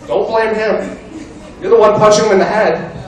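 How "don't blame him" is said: ordinary talk, nothing marked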